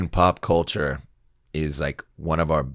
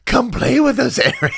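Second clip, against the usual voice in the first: very high, loud mock horror-child voice; hammed-up eerie invitation in falsetto; twins from The Shining; 8 dB louder than usual. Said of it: "those twins from The Shining".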